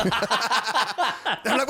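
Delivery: ordinary delivery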